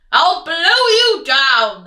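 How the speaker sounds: loud threatening wolf; loud menacing wolf; wolf threat. Acted. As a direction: bark the threat. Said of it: "the wolf replied".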